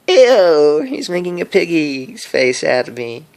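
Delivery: squealing high "Ew!" then giggly complaint; mock disgust. Vlogger mock-disgusted at his own piggy face. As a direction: pretend to be grossed out by a silly face.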